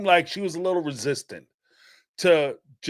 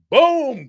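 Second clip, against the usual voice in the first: loud high mock boom; imitated gunshot; 9 semitones higher, 8 dB louder than usual. Telling how the grandfather fired through the door, loud boom. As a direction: bark out the gunshot sound.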